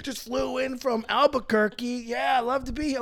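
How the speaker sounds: lightly voiced stand-up comic opener; hint of a cheesy comedian. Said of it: "as a stand-up comedy routine".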